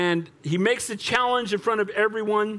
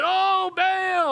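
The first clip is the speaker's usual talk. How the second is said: very high, stretched-out call; hammed-up wail to a silent god; crying out; 12 semitones higher than usual. Prophets six hours in the heat, high long cry. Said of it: "they're just crying out to Baal".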